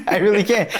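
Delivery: high-pitched, through a laugh; laughing admission. Real, not acted. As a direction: laugh while owning up to it. Laughs while admitting his temper.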